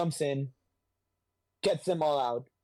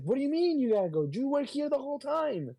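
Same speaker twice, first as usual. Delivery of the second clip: much higher and fast, outraged squeak; mock indignant disbelief; 12 semitones higher than usual. Retelling Ben Grimm, squeaky indignant disbelief at Spider-Man. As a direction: squeal it in fake outrage at being left. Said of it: "It's like".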